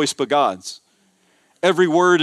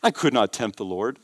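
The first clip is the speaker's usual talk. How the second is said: soft, low, falsely humble refusal; hushed sanctimonious modesty, acted out; mock pious; 4 semitones lower, 7 dB quieter than usual. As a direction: imitate someone demurring religiously, voice dropped. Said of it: "It sounds super religious".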